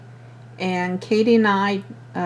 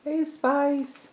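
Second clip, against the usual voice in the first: pet voice; 8 semitones higher, 6 dB quieter than usual. Real, not acted. Calling the cat sweetly.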